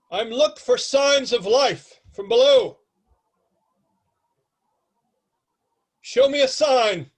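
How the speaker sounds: loud, high-pitched grandiose proclamation; Patrick Stewart. Acted. Airship captain seeks signs of life below, grandiose shout. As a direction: call down grandly, stretching the words. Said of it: "you hear this deep, grandiose voice".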